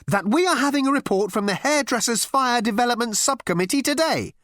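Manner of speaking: high-pitched, officious firmness; firm. A narrator's reading. Girl telling Ford about the report, firm. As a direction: insist on the agenda. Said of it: "continued the girl firmly".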